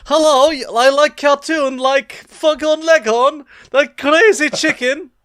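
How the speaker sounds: mocking a Russian tourist to a friend, loud and high; Russian accent. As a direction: play a Russian trying hard to sound American. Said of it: "a Russian accent trying to blend in in America".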